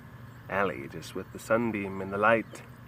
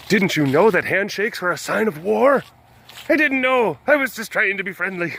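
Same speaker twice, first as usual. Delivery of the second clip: acted dialogue; 9 semitones higher, 5 dB louder than usual. Acted dialogue, high and loud.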